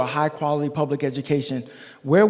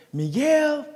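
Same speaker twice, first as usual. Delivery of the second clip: high, drawn-out name call; mother's emphatic call; 11 semitones higher than usual. Retold or imitated. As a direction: call a child in to get their attention. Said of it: "put some emphasis on that name".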